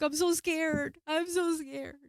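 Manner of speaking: high, drawn-out acted whimper; scared. Telling the helper her fear in the retelling, scared wail. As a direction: wail it like a frightened plea.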